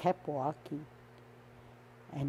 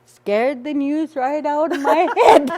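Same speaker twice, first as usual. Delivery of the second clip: laughing; 8 semitones higher, 10 dB louder than usual. Real, not acted. Punchline of her own story.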